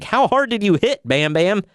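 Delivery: high, disbelieving question; incredulous. Retold or imitated. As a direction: ask it in total disbelief. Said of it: "I'm like".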